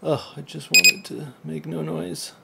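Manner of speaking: usual speaking voice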